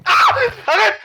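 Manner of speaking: sudden scared cry; real fright shout; startled yell. Real, not acted. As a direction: jolt out of fear. Jump scare, yelling stop.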